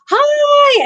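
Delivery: bright, very high, loud little "Hi"; excited hi. Retold or imitated. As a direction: wave to the crowd with a squeaky hello. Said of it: "she's like".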